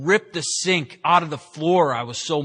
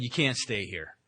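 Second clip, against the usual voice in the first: soft, final no; quiet firm refusal; 5 semitones lower, 9 dB quieter than usual. Retold or imitated. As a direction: say it softly but firmly. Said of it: "she said".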